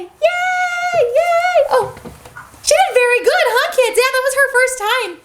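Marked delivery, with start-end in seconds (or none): 0.0-1.6 high excited cheer; cheering yay. Shown as a demonstration. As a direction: cheer it out happily. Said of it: "And then you gotta yell".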